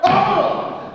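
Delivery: loud high cry of impact; punch grunt. Acted. Kronos crying out as Zeus punches him. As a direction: yelp as the blow lands. Fight sound effects.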